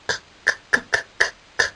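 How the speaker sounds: whispered k k k clinks; clanking keys. A narrator's reading. Keeper's keys clanking, whispered clicks.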